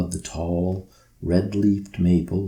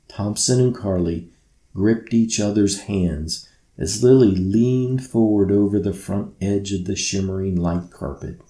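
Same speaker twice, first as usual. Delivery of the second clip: ordinary delivery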